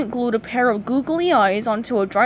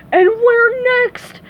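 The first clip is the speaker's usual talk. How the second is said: high, loud, slow wail; drawn-out cartoon panic; scream; 11 semitones higher, 6 dB louder, much slower than usual. A narrator's reading. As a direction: scream it out in dread. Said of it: "screamed Patrick".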